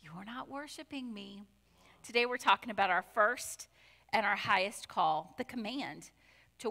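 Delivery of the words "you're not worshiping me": very soft voice of the Lord; soft, understated reproach; quiet imagined reproach. Retold or imitated. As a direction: say it hushed, as a gentle reminder. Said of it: "looks at you and says".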